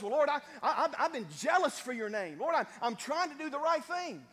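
loud, high, desperate pleading to the Lord; Elijah pleading. Acted. Elijah defending himself to God, loud desperate plea. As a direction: beg your case, voice rising and straining. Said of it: "Elijah says".

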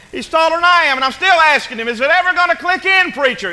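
loud, high-pitched acted complaint; exasperated parent. Worn-out parent complaining to the preacher, exasperated. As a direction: plead loudly at the end of your rope.

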